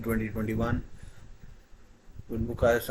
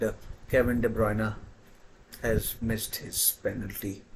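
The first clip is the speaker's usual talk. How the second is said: ordinary talk, nothing marked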